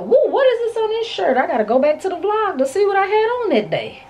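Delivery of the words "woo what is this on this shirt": amazed woo. Retold or imitated. Retold surprised reaction to shirt smell.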